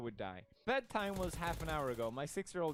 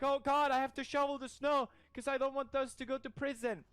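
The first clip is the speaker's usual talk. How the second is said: put-on whiny child self; 8 semitones higher, 4 dB louder than usual. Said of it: "And I'm like".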